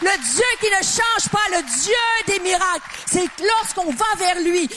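high, building preacher's fervour; rising preaching. Acted. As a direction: lift the congregation with rising conviction. Preaching climax at full force.

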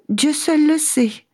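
high tearful woman voice; sad woman. Acted. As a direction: sigh it out like a weeping woman. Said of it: "répondit-elle".

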